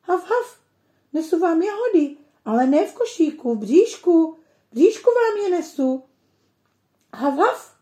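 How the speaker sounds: high, loud yapping puppy voice with woofs; a puppy barking to mother in the kitchen; barking dog. A narrator's reading. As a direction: bark it happily like a proud little dog. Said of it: "a štěká".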